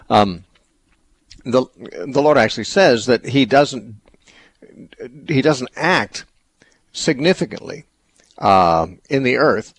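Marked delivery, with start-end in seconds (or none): none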